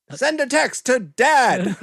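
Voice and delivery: loud, very slow, over-clear command; yelling at phone. Pretend. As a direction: shout it slowly at the phone. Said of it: "yell it like you're yelling at your phone".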